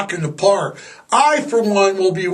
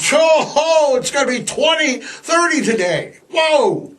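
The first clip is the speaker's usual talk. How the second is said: mock excited shout; 7 semitones higher than usual. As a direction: shout it like a giddy local. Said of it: "you're gonna be like".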